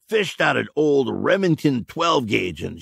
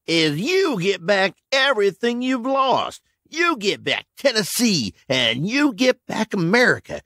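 raised, emphatic salesman; grand pitch; 9 semitones higher than usual. A narrator's reading. Tasker to Harlan, emphatic pitch. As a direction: push the promise hard.

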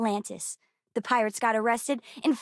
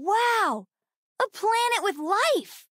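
high, wonderstruck exclamation; excited awe, voice lifted high; amazed; 7 semitones higher, slower than usual. Acted. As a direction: marvel at it, stretching the words. Amazed at discovering life.

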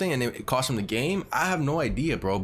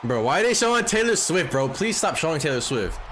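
exasperated; 6 semitones higher than usual. Real, not acted. Irritated at [name] shots.

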